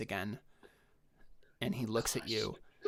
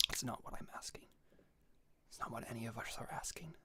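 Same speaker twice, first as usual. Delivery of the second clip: whisper; 5 dB quieter than usual. Acted. GM whispering as NPC pleading.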